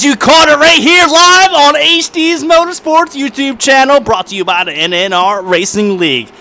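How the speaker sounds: loud, high announcer hype. Pretend. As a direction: pitch it like a TV host. Mock broadcast sign-off.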